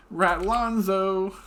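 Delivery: slow, drawn-out proclamation of the name; grand proclamation. Acted. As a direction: announce it like a royal title, slowly.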